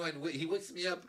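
normal talking voice

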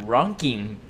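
"Ronking" said with an English r and a nasal ng, like ronking; sister's mispronunciation. Retold or imitated. Brothers mocking sister's English, "Ronking" said as she did. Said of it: "She said".

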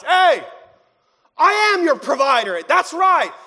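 loud, high, commanding call; shouting back. Acted. God answering those who remind him, shouting back. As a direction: shout it out as a rousing assurance. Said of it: "shouts back to us".

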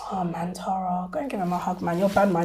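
whispering to herself to calm down; whispered self-talk. Retold or imitated. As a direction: scold yourself under your breath. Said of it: "I'd always be like".

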